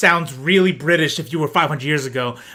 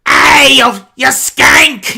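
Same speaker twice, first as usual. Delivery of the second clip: higher and louder slow shouted insult; mock rowdy shout; 8 semitones higher, 8 dB louder, much slower than usual. Host mocking old English insults as a mock rowdy shout. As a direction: bellow it like a tavern brawler. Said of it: "But then it's like".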